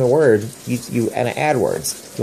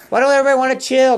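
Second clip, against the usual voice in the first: high, quick mock complaint; playful exclaiming; 11 semitones higher, faster than usual. Parent teases everybody with the practice word, playfully exclaiming. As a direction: throw it out high to the whole room.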